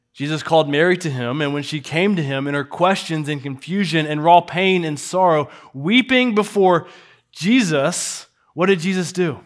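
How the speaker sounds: usual speaking voice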